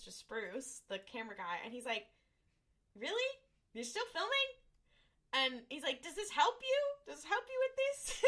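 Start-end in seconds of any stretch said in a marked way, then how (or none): 3.0-4.5 much higher, disbelieving question; incredulous. Retold or imitated. Dean asks the camera guy in high disbelief. 6.0-8.2 high, sing-song sarcastic question; sarcastic mock. Dean sarcastically needling the camera guy, high and sing-song.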